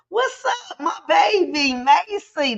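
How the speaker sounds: light up and greet your favourite niece; real delighted shout-out, laughing and high; excited greeting. Real, not acted. Greeting niece mid-talk.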